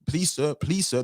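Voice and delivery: a lightly voiced servile plea, begging sir; mock pleading. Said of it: "this is how it sounds".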